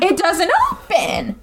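drawn out, deadpan insistence; slow insistent repeat. Retold or imitated. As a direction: say it slowly, flatly insisting. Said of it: "It's just glass".

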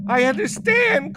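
high, loud tearful cry; crying in terror. A narrator's reading. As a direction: sob it out, terrified. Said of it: "cries Devra".

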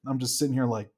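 normal talking voice

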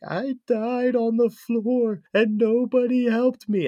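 high, loud, drawn-out spooky wail; ghost voice. Acted. Ghost haunting him as he sleeps, eerie slow wail. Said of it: "It's like a ghost voice".

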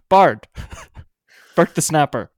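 loud call across the room; shout. Acted. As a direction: yell it out to the bard. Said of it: "I shout out to the bard".

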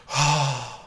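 long, open, dreamy sigh; big audible sigh of adoration; blissful sigh. Retold or imitated. Blissful reaction to the angel.

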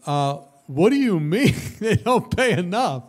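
laughing, hammed-up outrage; mock astonishment. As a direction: act out being stunned. Retold outraged question, laughing.

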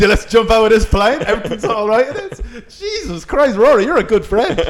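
high, hammed-up Australian accent; a frantic mock Australian, breaking into laughs; frazzled Australian. Possessed skydiver rallying his good friend, frazzled Australian. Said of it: "the demon infested frazzled Australian".

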